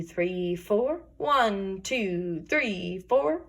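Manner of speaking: ordinary delivery, nothing marked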